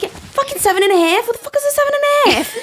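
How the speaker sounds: very high, hammed-up Long Island squawk; Long Island accent. Host imitating worker baffled by shoe size, high exaggerated Long Island accent. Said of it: "This is so fucking Long Island".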